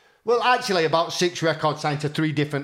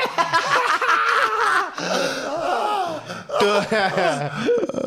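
much higher, long rising laugh; 13 semitones higher than usual. Real, not acted. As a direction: crack up at your own joke. Laughing at own bit.

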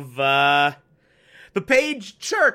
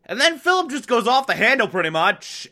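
usual speaking voice

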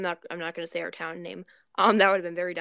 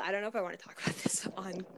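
whispering; 6 dB quieter, faster than usual. Real, not acted. Hesitant about sharing story.

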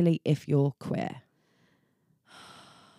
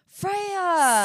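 high, drawn-out exasperated name; 13 semitones higher than usual. Real, not acted. Friend scolding Freya, high exasperated call. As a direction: scold her by name, shocked.

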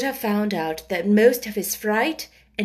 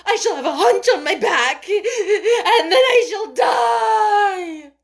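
sobbing wail; 11 semitones higher, 7 dB louder than usual. A narrator's reading. Hysterical boy fears he shall die, wailing. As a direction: wail in despair through sobs. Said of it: "and sobbed and wailed".

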